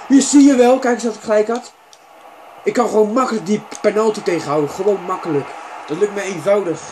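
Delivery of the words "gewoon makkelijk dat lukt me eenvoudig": normal talking voice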